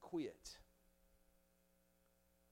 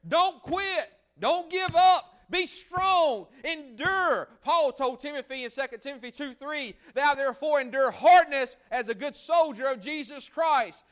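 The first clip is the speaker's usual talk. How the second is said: preaching at full force; 8 semitones higher, 13 dB louder than usual. Acted. Pastor exhorting his church to stand fast, full-force preaching. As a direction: thunder it out to the congregation.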